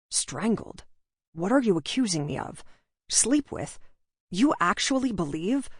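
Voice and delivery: understated anger, building in disbelief; indignant questions, mildly heated; escalating anger. A narrator's reading. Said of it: "His anger escalated with each word".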